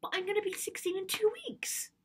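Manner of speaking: put-on high indignant teenage protest. Daughter arguing to take Dad's car, indignant. Acted teen protest to dad.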